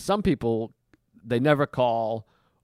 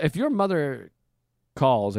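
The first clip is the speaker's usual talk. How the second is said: normal talking voice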